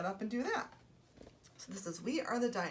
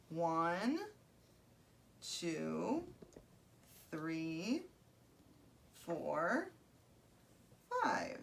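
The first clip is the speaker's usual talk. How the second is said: slow counting; 3 semitones lower, 3 dB quieter, much slower than usual. Real, not acted. Counting figures onto the board.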